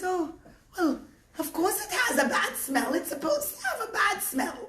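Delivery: lightly voiced tittering granny; matter-of-fact old woman, hint of a laugh. Old woman answers, amused.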